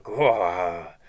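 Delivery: low growled "Gwah" roar; mock roar. Reader showing a tiger roaring at the tamer, a low growl. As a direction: play a lion tamer's beast growling. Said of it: "a tiger maybe going".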